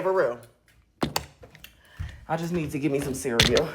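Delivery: usual speaking voice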